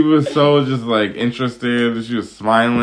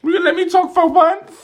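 much higher and louder squeaky mimicry; mock indignant demand; 4 dB louder than usual. Mimicking Gary demanding to talk at dinner, high indignant squeal. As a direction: squeal it like a spoiled diva.

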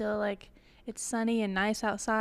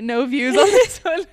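loud; 4 semitones higher, 12 dB louder than usual. Real, not acted. Loud laughing remark.

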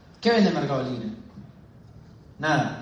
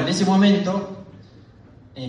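ordinary talk, nothing marked

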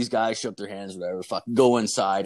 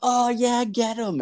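put-on much higher kid voices egging on a fight; cheering kids; 14 semitones higher, 3 dB louder, slower than usual.

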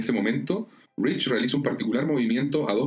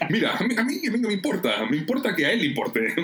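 insistent, higher-pitched dismissal; agitated protest; 5 semitones higher than usual. Acted. Jeff arguing about what matters to him, agitated protest. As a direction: protest indignantly in a raised pitch.

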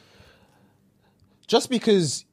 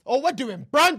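gassed; 6 semitones higher than usual. Retold or imitated. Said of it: "and he's gassed".

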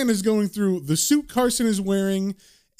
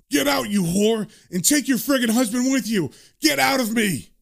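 acted outburst; 5 semitones higher than usual. Possessed man snaps and lashes out.

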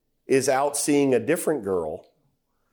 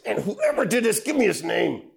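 high-pitched demand for the culprit's name; a raised, angry voice from the preacher as David; furious king; 8 semitones higher than usual. Acted. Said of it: "David is furious".

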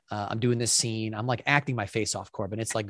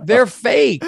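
loud, high retold protest; exasperated retort; 12 semitones higher, 13 dB louder than usual. Actor defending himself to his angry sister, exasperated retort. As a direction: fire back in mock exasperation, pitched way up. Acted quarrel, answering the accusation.

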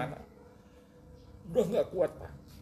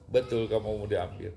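lightly voiced voice from above, lower and firm; a hint of thundering authority; booming divine voice; 4 semitones lower, 5 dB louder than usual. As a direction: question him like a great voice from nowhere. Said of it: "gelegar gede".

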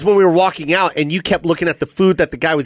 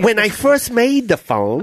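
slow, higher mock Chinese accent; 5 semitones higher, slower than usual. Restaurant worker recalling making the phone, mock Chinese accent. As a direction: reminisce slowly in a put-on Chinese accent. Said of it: "You get to do that accent".

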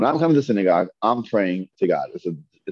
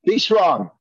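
higher, punchy exclamation; emphatic exclamation; 7 semitones higher than usual. Shown as a demonstration. Teacher showing why there's an exclamation mark, emphatic and exclaiming. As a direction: proclaim it with a jump in pitch.

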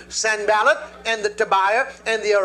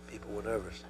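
hushed aside; 9 semitones lower, 17 dB quieter than usual. Real, not acted.

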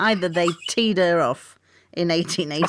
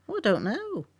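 ordinary delivery

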